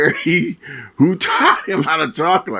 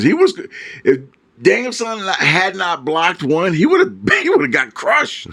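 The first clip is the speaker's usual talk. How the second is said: high-pitched excited telling; 6 semitones higher than usual. Real, not acted. Excited retelling.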